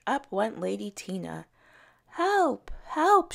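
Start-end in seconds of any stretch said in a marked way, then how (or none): 2.1-3.3 much higher, louder desperate cry; shouting for help. A narrator's reading. Lady Tina snatched by the dragonfly, much higher loud shout. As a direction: scream for help as you're carried off. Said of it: "she shouted".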